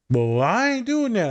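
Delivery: high, slow stubborn student voice; a student refusing to change, voiced high and slow; mock defiant student. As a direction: whine it up high like a stubborn kid.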